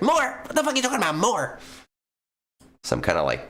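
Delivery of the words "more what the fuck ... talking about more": much higher, put-on incredulous squawk; cartoonish high-pitched disbelief; mock Bones outrage. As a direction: play a friend baffled and indignant, badly faked. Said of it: "I can't do a Bones voice".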